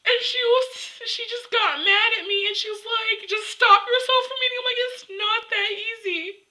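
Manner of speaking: high, upset and close to sobbing; real tearful voice. Real, not acted. Telling how mom reacted to her plea, tearful. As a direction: recount it through tears. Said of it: "Okay, I'm gonna cry".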